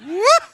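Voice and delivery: sudden high whoop; high yelp. Shown as a demonstration. Yawn arrives.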